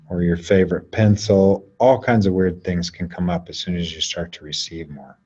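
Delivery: usual speaking voice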